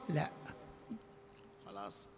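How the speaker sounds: ordinary talk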